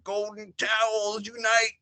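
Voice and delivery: hammed-up fan battle cry; mock Steelers fans. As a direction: imitate the fans gathering to attack. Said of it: "They were just like".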